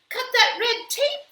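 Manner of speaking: high, bright-eyed character voice; the maid proudly explaining to the mayor, high and loud; Amelia Bedelia. A narrator's reading. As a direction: say it high and cheerful. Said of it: "said Amelia Bedelia".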